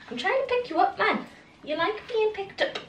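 high soft puppy talk; sweet talk to a wriggling puppy; squeaky praising a dog. Real, not acted. Picking up the puppy.

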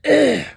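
real loud choking cough; hacking cough. Real, not acted. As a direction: hack it out hard. Pepper dust in throat.

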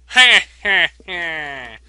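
loud, drawn-out villain cackle; Wario. Acted. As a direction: cackle slowly and loudly like a cartoon bad guy. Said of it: "Yes, he sounds like Wario".

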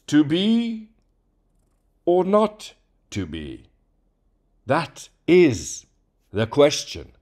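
loud, high, hammed-up Hamlet declamation; obnoxious overacting. Shown as a demonstration. Demoing Hamlet for the voice changer, obnoxiously overacted. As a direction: overplay every line like a ham actor. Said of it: "how obnoxious it sounded when I did it".

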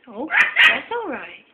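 ordinary talk, nothing marked

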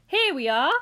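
loud, cheery announcement; loud showman's cry; cried out. A narrator's reading. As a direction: call it out to the whole group. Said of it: "cried Mister Wonka".

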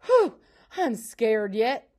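loud, slow puff of lingering fright; out of breath. A narrator's reading. Pa just home from fleeing a panther, out of breath. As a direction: puff it out, shaken from running. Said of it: "He was out of breath".

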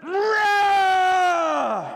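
high drawn-out roaring yell; lion roar shout. Acted. Preacher voicing the beast's roar at the sheep lion, long shout. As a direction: roar it like a lion across the river. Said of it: "from the inside of the little sheep line".

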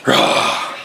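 a tiny whispered monster roar. Pretend. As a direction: breathe the roar out with no voice. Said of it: "let me hear that Hulk".

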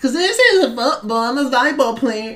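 high, laughing mock voice; mocking teammate quote. Gamer mimicking KG spiking the ball, high mocking voice. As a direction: imitate a clueless player, squeaky and amused. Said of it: "K G decides".

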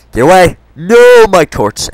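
high, loud startled yell; panicked shout. Real, not acted. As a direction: scream at the sudden attack. Startled by a mob.

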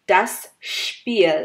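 Spiel with a heavy shp onset; weight the s of Spiel as shp. Shown as a demonstration. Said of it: "একটু এস এর উপরে ভার দিয়ে". (Spiel এর স এর উপরে ভার, শ্পীল)

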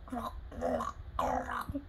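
low, soft gulping glugs; glug sound. Acted. Ruby gulps water, low soft glugs. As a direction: gulp the glugs deep and soft.

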